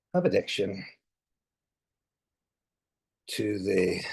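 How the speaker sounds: halting, a word or two at a time; spaced-out words, as if typing along; dictating while typing. Real, not acted. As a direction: dictate slowly with long pauses. Dictating slowly while typing the post.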